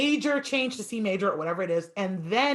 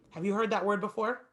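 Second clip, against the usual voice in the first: ordinary talk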